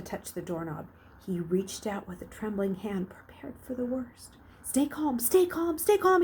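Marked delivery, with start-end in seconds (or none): 4.6-6.2 rising higher and louder with each stay calm; anxious self-talk. A narrator's reading. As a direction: panic building as he tells himself to stay calm. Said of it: "he told himself".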